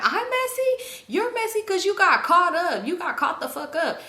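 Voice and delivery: high-pitched, drawn-out disbelief, then easing down; indignant comeback. Retold or imitated. Friend called messy hits back at her accuser, indignant. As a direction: imitate an offended friend turning the accusation back.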